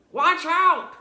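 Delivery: loud sharp warning cry; alarmed warning. A narrator's reading. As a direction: shout the warning at the room. Eugenia warns of piglet.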